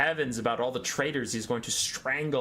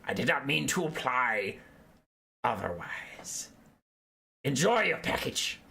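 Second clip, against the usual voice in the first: appeasing rat minion. Acted. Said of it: "and goes".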